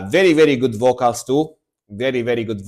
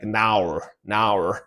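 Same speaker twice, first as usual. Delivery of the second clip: mock Australian "naur, naur", a touch understated; mock Australian accent; 4 semitones lower, 5 dB quieter than usual. Joking how Australians say no, in mild Australian accent. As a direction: toss off "Now, now" in a lightly done Australian twang. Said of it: "This sounds like what Australian people say".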